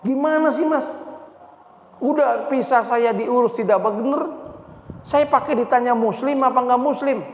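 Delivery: high-pitched indignant retelling; angry. Retold or imitated. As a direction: act out an affronted customer, pitch jumping up. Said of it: "Tambah marah".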